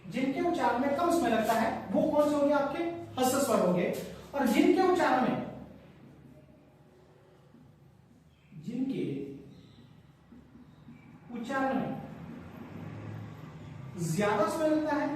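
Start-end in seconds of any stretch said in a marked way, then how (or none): 4.3-15.1 slow, chunked phrases with long pauses between; slow dictation. Shown as a demonstration. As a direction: spell it out slowly as if writing on the board. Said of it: "यहां पर मैं लिख देता हूं".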